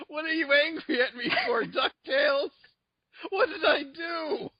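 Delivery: high, loud, whiny bewilderment; hammed-up wounded complaint, laughing midway; mock hurt protest. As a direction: plead as if wrongly yelled at. Mock hurt reply to angry song.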